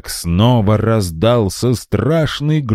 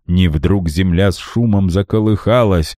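usual speaking voice

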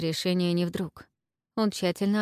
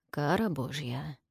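quiet musing repeat; 3 semitones lower, 6 dB quieter, slower than usual. Acted. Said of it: "повторил Роман".

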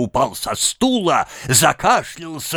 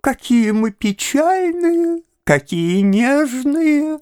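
high, slow cooing with lips pushed out; cooing baby talk; 14 semitones higher, slower than usual. Acted. Monsieur Pierre coos at Cincinnatus like at a pouting child. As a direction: coo as if teasing a sulking child. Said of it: "как если бы хотел рассмешить надувшегося ребёнка".

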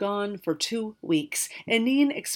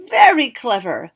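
louder delighted praise; enthused; 3 semitones higher, 13 dB louder than usual. Acted. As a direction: beam at the girl's trick. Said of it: "the dragon enthused".

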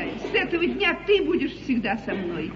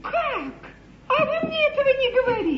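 surprised outburst; 11 semitones higher than usual. Acted. Королева узнаёт неожиданную новость.

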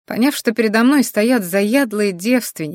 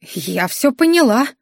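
put-on slow, higher, cowed old woman; pitched-up, anxious submission; frightened assurance; 5 semitones higher, slower than usual. A narrator's reading. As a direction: yield with a touch of fear. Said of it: "быстро заверила меня испуганная старуха".

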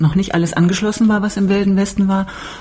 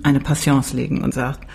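ordinary talk

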